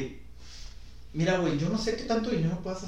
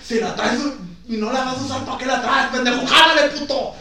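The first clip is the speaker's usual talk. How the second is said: rising louder, higher furious dare; angry taunt; 8 semitones higher, 8 dB louder than usual. Retold or imitated. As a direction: taunt the robber to pull the trigger. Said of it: "yo me hubiera puesto de".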